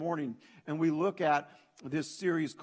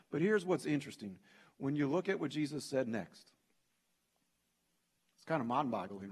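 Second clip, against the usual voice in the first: usual speaking voice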